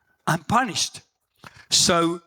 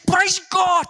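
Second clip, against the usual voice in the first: a sudden cry of praise, much higher and louder; joyful shout; 13 semitones higher, 5 dB louder than usual. Retold or imitated. Police officer bursting out to colleagues, loud joyful shout.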